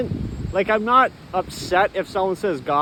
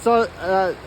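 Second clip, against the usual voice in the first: normal talking voice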